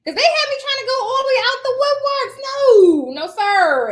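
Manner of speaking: high, loud protest with a big "no"; exaggerated indignant refusal; comic outrage. Real, not acted. Comic refusal of far-off job.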